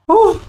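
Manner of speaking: a high, loud whew of exhaustion; tired whew. Real, not acted. Vlogger pauses packing, blows out a loud tired whew. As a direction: blow it out after packing. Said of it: "I'm out of breath".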